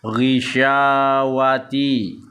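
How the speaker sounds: slow, drawn-out Arabic syllables on an even tone; measured Quran-reading demonstration; Iqra recitation drill. Shown as a demonstration. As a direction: chant each syllable long and steady. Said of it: "di tenggorokan".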